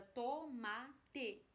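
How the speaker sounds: "tomate" broken into to, ma, te; syllable by syllable. Shown as a demonstration. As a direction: say "tomate" in separate syllables with clear gaps. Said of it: "com as sílabas pra achar a palavra".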